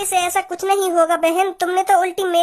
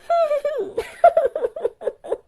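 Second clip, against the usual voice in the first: mock crying sound that the uncle takes for real tears; fake sobbing; 6 semitones higher than usual. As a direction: let a mock cry break out instead of words. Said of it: "बेटी रो मत".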